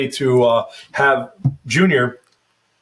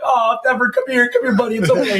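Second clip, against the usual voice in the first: cooing to dog; 8 semitones higher than usual. Retold or imitated. A light coo to Denver after sad ads. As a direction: coo over the dog like a baby. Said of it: "We see that, we're like".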